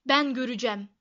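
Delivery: loud bossy demand; commanding. A narrator's reading. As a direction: order it like a spoilt boss. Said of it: "diye buyurdu". (buyurgan bir sesle)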